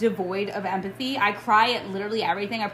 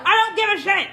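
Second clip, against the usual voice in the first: high, loud exasperated shout; real frustration boiling over at the top of the voice; exasperated outburst; 10 semitones higher, 7 dB louder than usual. Real, not acted. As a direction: yell it, completely fed up. Exasperated rant against those defenders.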